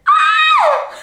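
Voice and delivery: loud, very high-pitched squeal; excited scream. Real, not acted. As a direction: let out a piercing scream of excitement. Overcome with trip excitement.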